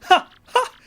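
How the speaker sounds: big, high, loud theatrical ha-ha cackle; gloating laugh. Acted. Flavio mocking Mario for having no ship, hammy gloating laugh. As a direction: burst into a hammy triumphant laugh.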